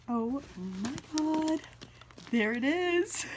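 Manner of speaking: slow, high, amazed; awed. Real, not acted. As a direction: marvel at it. Admiring the bag.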